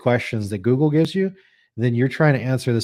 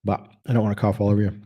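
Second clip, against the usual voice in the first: ordinary delivery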